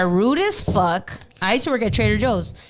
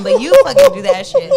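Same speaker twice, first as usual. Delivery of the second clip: mock shout; 7 dB louder than usual. Retold rant at work, shouted.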